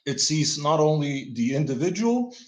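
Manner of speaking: ordinary delivery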